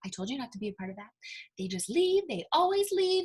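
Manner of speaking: little voice nagging privately in the ear; gossiping child self. Acted. Eight-year-old self gossiping in her ear, hushed childlike nagging. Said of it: "gossiping to me in my own ear".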